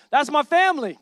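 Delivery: proud call to the crowd. Real, not acted. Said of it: "Give it up for my family".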